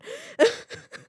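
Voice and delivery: airy burst of laughter; high breathy laugh. Real, not acted. Joke about men.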